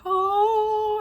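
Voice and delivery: long, high heavenly "oh"; awestruck. Retold or imitated. Re-enacting finding the ball in the bag, high drawn-out awe. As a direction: let it ring like a revelation.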